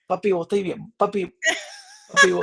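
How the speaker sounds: laughing imitation of classmates saying papi, last one squeaking up high; classmates' mock Zulian papi. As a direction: tease with papi, climbing to a squeal. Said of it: "me decían". (imitando a las compañeras diciéndole "papi", riendo y subiendo muy agudo)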